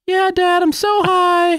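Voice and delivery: put-on tiny, very high kid voice; stoned two-year-old. Dad imitating his kids, high-pitched stoned toddler. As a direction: squeak it like a spaced-out toddler. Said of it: "the stoned two-year-old".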